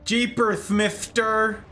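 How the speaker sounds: put-on high, slow gee-whiz kid; wholesome 1950s kid. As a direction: drawl it like a wide-eyed fifties kid.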